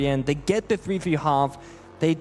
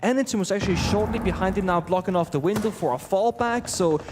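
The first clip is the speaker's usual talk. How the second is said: excited play-by-play; 5 semitones higher than usual. Acted. Play-by-play of the round.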